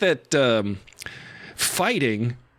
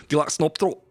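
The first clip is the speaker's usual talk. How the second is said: light mock Scottish accent; 3 semitones higher, faster than usual. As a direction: tease a Scottish friend in a hint of Scottish accent. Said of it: "Let me try it again".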